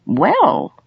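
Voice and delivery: high, pleased exclamation; delighted. A narrator's reading. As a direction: let delight lift the word.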